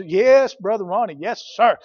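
mock-hearty, punched-out yes to the preacher; eager agreement. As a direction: imitate a parent who is all for it. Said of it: "They were all for it".

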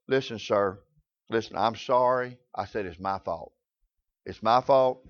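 low, soft, placating voice; calm him down quietly; de-escalating apology. Retold or imitated. Store manager appeasing an angry customer, low soft apology. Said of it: "So I tried to de-escalate that".

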